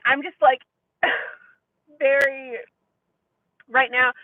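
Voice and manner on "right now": ordinary talk, nothing marked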